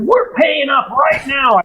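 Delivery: high, slow mock insistence; adamant client. Client insisting on paying now, high slow insistence. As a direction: dig in and insist. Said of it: "He said".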